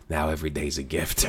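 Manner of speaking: low, quiet mock Tony Soprano; a hint of a solemn Tony Soprano; low mock-solemn gangster line; imitating Tony. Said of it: "Remember in The Sopranos when Tony".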